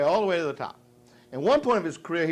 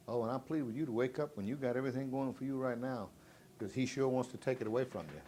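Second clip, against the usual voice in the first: ordinary talk, nothing marked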